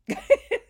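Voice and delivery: high loud burst of laughter. Real, not acted. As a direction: crack up helplessly. Laughing to tears over report.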